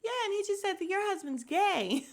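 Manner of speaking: high, blithely matter-of-fact mimicry; amused imitation of a blunt lady. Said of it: "the way this lady said it so matter of fact".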